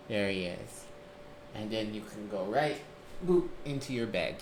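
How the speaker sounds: normal talking voice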